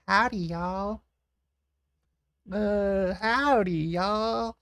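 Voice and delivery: high, loud cartoon American howdy. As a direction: belt out a hammed-up American twang. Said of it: "American accents".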